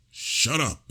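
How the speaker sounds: sharp high yell at the screaming ghost. A narrator's reading. Man yells at a screaming ghost in his room, high yell. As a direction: yell it up high to silence her. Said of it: "I yelled".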